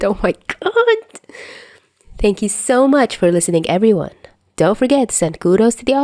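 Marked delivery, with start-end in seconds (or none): 0.0-1.0 high excited squeal. Real, not acted. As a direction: gush about it. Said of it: "I'm super excited".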